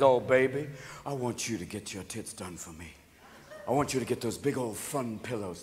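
low, hushed sweet-talk; soft, low mock-seductive man; coaxing big daddy. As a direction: murmur it seductively to a lover. Man coaxing partner in skit.

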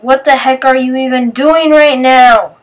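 exasperated. Retold or imitated. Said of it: "And Matthew's like".

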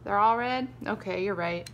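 ordinary delivery

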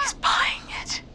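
tense excited whisper; hushed with rising hope. Acted. Hiding, whispering.

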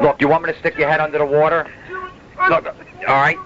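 high, menacing threat; threatening. Pretend. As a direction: threaten him sharply. Threatening grandpa in the tub.

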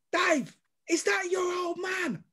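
high, drawn-out call at normal volume; imitated calling out. Rival fan calling to his mate, drawn-out mock shout. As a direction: stretch it out like calling across a stand. Mate calls across to him.

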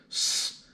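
a soft snake hiss. Shown as a demonstration. Teacher shows children the letter sound, soft hiss. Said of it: "a big S".

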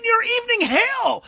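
emphatic outburst. Retold or imitated. Climax of advice.

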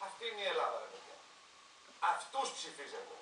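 quiet bitter aside. Real, not acted. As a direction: say it under your breath, disgusted. Bitter aside about voters.

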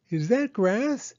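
put-on high, slow child's question; Penny asking Mama about the shoots, high and slow; curious little girl. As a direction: wonder aloud like a small girl. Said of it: "asked Penny".

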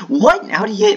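shrill high what, then loud disbelief; real startled outcry; outraged surprise. Real, not acted. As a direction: yell out in shock at being caught. Outraged at losing.

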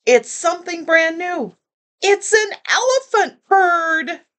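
high, amazed cry; lightly acted delighted crowd; shouted. A narrator's reading. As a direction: marvel at the sight. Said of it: "they shouted".

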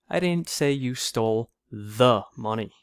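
"the" punched and set apart; pause, then lean hard on "the"; deliberate, heavy emphasis on "the"; stress on THE. Shown as a demonstration. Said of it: "with emphasis on each word".

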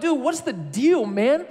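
high-pitched, indignant retold plea; hurt, shocked protest. Boy dropped by his best friend, protesting in hurt. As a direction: plead your case to a friend, hammed up and high. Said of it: "I was so hurt and confused and shocked".

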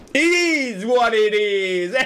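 high, drawn-out wail of resignation; loud resigned cry. Real, not acted. Resigned cry after a game mishap.

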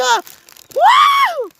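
높고 길게 외치는 환호; 썰매 타며 신나게 소리 지르기; excited shout. Real, not acted. Sledding down, shouting. (신나게 환호)

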